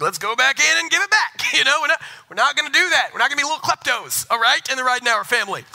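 high, loud mock-stern lecture; stern dad. Father scolding his daughter, loud hammed-up lecture. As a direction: scold like a dad hamming up the rules. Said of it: "I was like".

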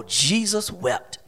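low, slow, very soft verse; hushed solemnity. Shown as a demonstration. Preacher repeating the shortest verse, hushed solemnity. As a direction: drop right down and let it sink in slowly.

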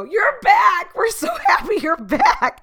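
very high, loud imitated squeal with laughter; hammed-up excited family welcome; mock overjoyed greeting. Imitates family greeting her return.

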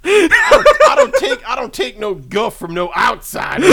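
laughing tough-guy shout; shouting match. Pretend. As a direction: yell it through laughter. Acted quarrel, laughing shout.

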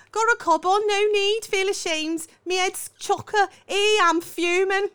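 high, loud attempt at Scouse; Scouse accent. Shown as a demonstration. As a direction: have a go at Scouse. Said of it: "I wanna do it".

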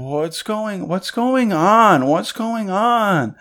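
high, slow, dazed question; drawn-out mock bewilderment; imitated frozen shock. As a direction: play her stunned, stretching the words out high. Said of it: "She's, like, frozen".

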